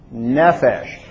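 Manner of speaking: ordinary delivery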